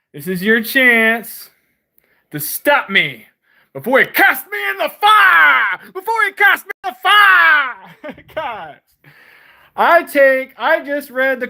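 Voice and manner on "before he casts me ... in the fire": mocking defiant shout. Real, not acted. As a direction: hurl the dare up at the sky. Taunting, defiant shout at God.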